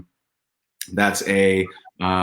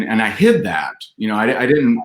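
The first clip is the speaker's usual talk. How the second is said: normal talking voice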